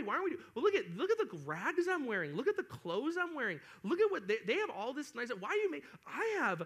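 high, swooping mock-child whine; hammed-up envious complaint; whining kid. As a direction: pester your parents like a spoiled kid. Acting envious child whining at parents.

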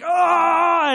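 loud, high mock yell of effort; hammed-up heaving cry, as if lifting a piano; straining yell. Acts out straining to lift piano.

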